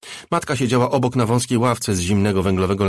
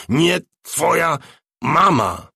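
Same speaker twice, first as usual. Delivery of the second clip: louder, slower, rising desperate shout; mock shout; 8 semitones higher, 5 dB louder, much slower than usual. A narrator's reading. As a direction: tear the words out in a strained yell. Tik shouts at the false mother.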